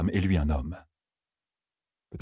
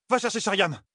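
urgent called-out order; 12 semitones higher than usual. A narrator's reading. Nineveh sends Elaine for help, an urgent high order. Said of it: "lança-t-elle à Elaine".

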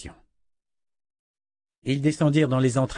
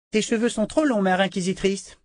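put-on a haughty girl sneering at a grown-up; spoiled little princess; 8 semitones higher than usual. Princess mocks the Mother Confessor's hair, high bratty voice.

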